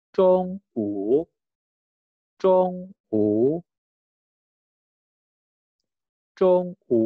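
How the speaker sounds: Zong u with Zong high and flat, u dipping; level first tone. Shown as a demonstration. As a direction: hold Zong high and level, then dip u. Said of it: "Nadanya pertama, nada datar".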